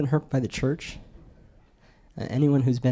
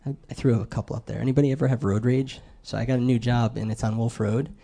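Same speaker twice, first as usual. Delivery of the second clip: ordinary delivery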